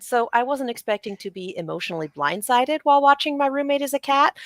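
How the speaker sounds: ordinary talk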